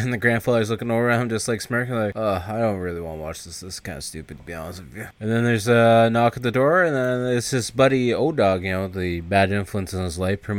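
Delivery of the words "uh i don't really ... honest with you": low, soft grumble, fading off; retold half-hearted protest, sinking away; reluctant grumble. Grandson not wanting to watch the movie, grumbling.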